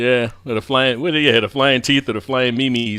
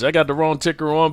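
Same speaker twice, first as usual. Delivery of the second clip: normal talking voice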